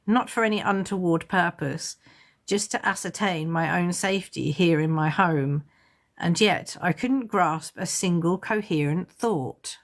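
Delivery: usual speaking voice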